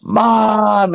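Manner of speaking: high frightened call of Mom; a scared kid shouting Mom; yell for mom. Retold or imitated.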